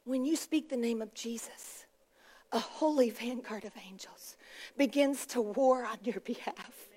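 very soft and low, near whisper; hushed preaching. Real, not acted. Preacher tells congregation of angels warring for them, hushed. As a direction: drop to an awed hush, phrase by phrase.

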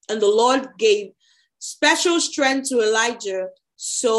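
animated, projected Bible narration; scripture reading aloud. A narrator's reading. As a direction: read the chapter out to the congregation. Reads Bible chapter aloud.

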